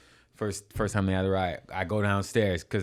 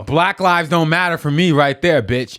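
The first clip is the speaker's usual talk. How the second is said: fired-up boast; 6 semitones higher, 9 dB louder, slower than usual. Real, not acted. Fired-up boast after the story.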